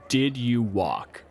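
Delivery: ordinary delivery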